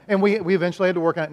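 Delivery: normal talking voice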